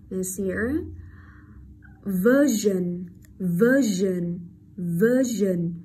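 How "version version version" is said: Version with -sion said as a buzzed zhn; voiced zh. Shown as a demonstration. Teacher shows S I O N rule, version with voiced zh. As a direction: model "version", drawing out the ZH. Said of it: "lưu ý cho cô âm Z".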